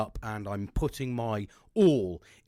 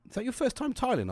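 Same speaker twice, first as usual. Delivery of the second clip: much higher, lightly voiced wife asking; a hint of his missus, curious; imitating wife; 12 semitones higher than usual. Wife to him while he tiles, lightly voiced. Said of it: "She was like".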